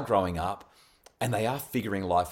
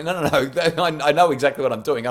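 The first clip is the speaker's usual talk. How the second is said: louder, laughing no-no-no; laughing overrule; 5 semitones higher, 7 dB louder than usual. Retold or imitated. A dad laughs off his daughter's call for process, louder and laughing. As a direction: wave her off with a laugh.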